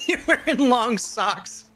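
high-pitched words through giggles; laughing. Real, not acted. Friend teasing streamer about an outfit, laughing. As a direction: tease while cracking up.

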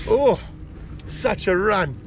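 ordinary talk

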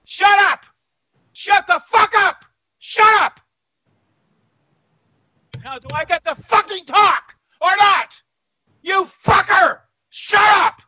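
real high-pitched furious yelling; enraged, out-of-control shouting; uncontrollable anger. Real, not acted. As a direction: scream to be given the floor. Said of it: "manifested in uncontrollable anger".

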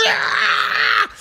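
high, strained imitation shriek; hammed-up high scream; mock scream. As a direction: imitate her screaming while pinned down. Imitates her screaming while held down.

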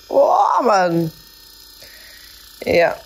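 wincing from a sore thigh; sore muscles. Real, not acted. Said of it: "Das zieht wirklich krass".

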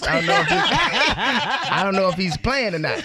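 laughing disbelief. Retold or imitated. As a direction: laugh helplessly through it. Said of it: "And I'm like".

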